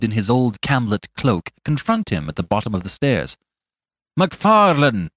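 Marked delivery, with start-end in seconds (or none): 4.2-5.1 higher, louder, like a herald; formal, ringing call of a name; somewhat loud hail. A narrator's reading. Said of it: "he said somewhat loudly, more like a herald".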